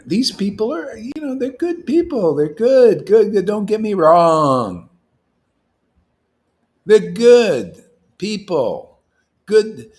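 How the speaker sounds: loud, high, drawn-out insistence; emphatic insistence. Real, not acted. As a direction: push it high and loud, stretching the last words. Insisting they are good people.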